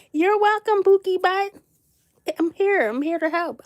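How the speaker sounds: high, sugary "you're welcome"; sing-song thanks to a viewer; sweet put-on voice.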